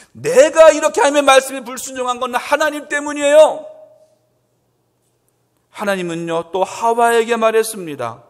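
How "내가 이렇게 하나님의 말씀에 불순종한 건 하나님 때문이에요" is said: much higher, louder protest; loud, aggrieved finger-pointing; indignant blaming. Acted. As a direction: whine it was not my fault. Adam blaming God, acted.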